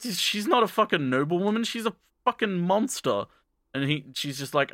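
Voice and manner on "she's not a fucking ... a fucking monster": higher-pitched, appalled retelling; mock-horrified realization; indignant disbelief. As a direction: act out a fugitive's incredulous protest. Acted shock while fleeing her.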